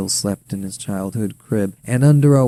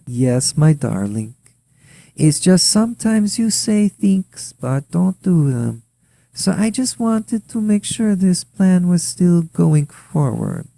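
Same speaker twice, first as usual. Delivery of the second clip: Ukrainian wife; 8 semitones higher than usual. A narrator's reading. Wife pressing her husband about his plan, higher accented voice. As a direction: coax him in her sweet accent.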